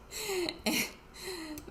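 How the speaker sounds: high-pitched real giggle; a giggle at her own mess. Real, not acted. Husband laughing behind camera.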